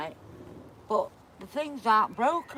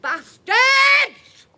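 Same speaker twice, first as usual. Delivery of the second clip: high loud yell of abuse; furious shout; 12 semitones higher, 13 dB louder than usual. Real, not acted. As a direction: scream it at them. Mum shouting at the kids.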